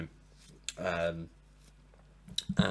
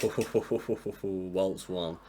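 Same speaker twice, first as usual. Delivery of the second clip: laughing. Real, not acted. Laughs saying the title.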